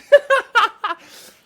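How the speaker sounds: cackling laugh at own anecdote; loud high laugh. Real, not acted.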